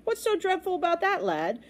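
high, lilting dismissal; breezy amusement. Acted. Captain humours the worried lad, breezily amused. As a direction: chuckle off the danger.

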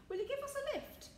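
high-pitched hopeful question from sailors; eager request. A narrator's reading. Sailors asking the driver for a lift, eager high-pitched question. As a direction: ask brightly, pitched well up.

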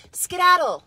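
loud, high shooing call; shooing away. Acted. Mother hurrying a child out, loud shooing. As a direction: send the child off loudly.

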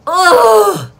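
loud, high-pitched "oh" cry; sudden acted outcry of alarm; startled cry. Mother seeing her daughter terrified at night, alarmed cry. Startled by evil spirit outside.

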